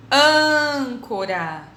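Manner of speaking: âncora with a long, loud first ÂN; stressed syllable stretched. Shown as a demonstration. Teacher modelling circumflex words, âncora with ÂN drawn out. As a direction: hold the opening Â of âncora. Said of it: "a sílaba mais forte".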